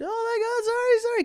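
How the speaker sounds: very high mock-panicked apology; freaking-out Balinese mom. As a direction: imitate a flustered mother in falsetto. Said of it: "she's freaking out".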